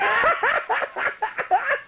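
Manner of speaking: real high-pitched laughter. Real, not acted. Laughing at own story.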